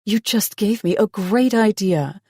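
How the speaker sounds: bright, eager, lifted voice; excited. A narrator's reading. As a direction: burst out with the idea. She gets an eager idea.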